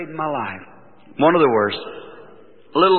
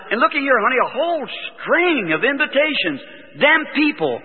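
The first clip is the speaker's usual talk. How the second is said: high-pitched retold enthusiasm; happy, excited; 8 semitones higher than usual. Husband shows wife his invitations, happily excited. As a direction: show off the news, voice lifted high. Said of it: "Why you sound so happy".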